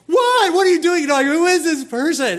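high, loud panicked babble; hammed-up panic at an intruder; totally freaking out. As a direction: shriek it like you're freaking out. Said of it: "totally freaking out".

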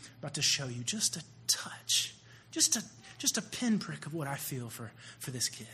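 quick then dropping to a whisper; God speaking softly. Retold or imitated. Preacher voicing God's gentle words about the kid, whispered. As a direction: let God's voice sink to a hush. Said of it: "It was like God said".

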